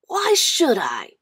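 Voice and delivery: calm, drawn-out refusal; boy keeping his gloves; boy refusing. Acted. John refuses.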